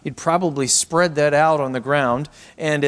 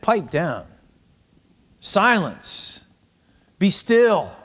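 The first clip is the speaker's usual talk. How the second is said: crowd's stern order, rising in pitch; mock threatening; 5 semitones higher, much slower than usual. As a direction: silence him with short, separate commands. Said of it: "it's almost a threatening terminology".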